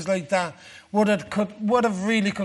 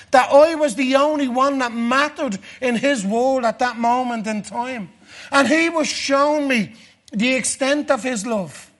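loud, fervent preaching, pitched higher; preaching at full force; 4 semitones higher, 6 dB louder than usual. Acted. Preacher to his congregation, loud and fervent. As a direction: build the fire of a revival sermon.

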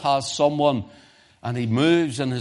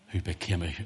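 usual speaking voice

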